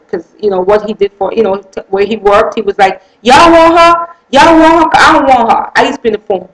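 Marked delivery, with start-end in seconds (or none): 3.2-5.7 loud, high jeering call to the guys; mocking husband calling out; mocking taunt. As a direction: taunt her loudly like the husband. Husband mocking her to coworkers.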